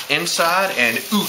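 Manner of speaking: Canadian "out" said as "oot"; mock Canadian accent. Joking to Canadian viewers, mock Canadian accent. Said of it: "for you Canadians".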